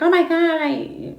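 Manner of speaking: high, loud mock gasp of shock; an imitated squeal of disbelief. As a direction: play the shocked reader who believes it. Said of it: "they're gonna be like".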